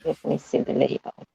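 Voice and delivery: whispered to herself; whispering. Real, not acted. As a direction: breathe the words softly. Checking the screen under her breath.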